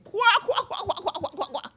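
high mocking cackle fading away; cackling laugh kwa kwa. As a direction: cackle at him, dying off. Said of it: "Malice started to laugh".

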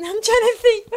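laughing. Real, not acted. Laughs at own directions.

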